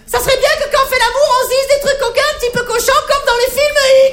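put-on high, coy girly voice; girlfriend's voice. Girlfriend asks him to talk dirty in bed, high coy voice. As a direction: mince it out like a shy girlfriend.

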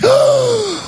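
big high audible breath; hammed-up relieved breath; gasp of relief. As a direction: let out an exaggerated gasp. Sigh of relief, mission accomplished.

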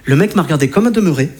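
ordinary talk, nothing marked

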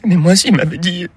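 voix de femme très aiguë et plaintive; veuve qui geint. Acted. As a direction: jouer la veuve qui se justifie. Veuve française pleurnicharde. (veuve française, voix très aiguë et plaintive)

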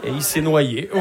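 ordinary talk

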